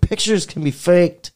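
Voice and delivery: high, loud scoffing reply; mock-incredulous comeback; scoffing retort. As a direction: brush off his photo proof. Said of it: "I was like".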